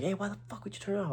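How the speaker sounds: high, softened mock yell; imitating pissed guy. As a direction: mimic his angry demand, high but held back. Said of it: "he looked pissed".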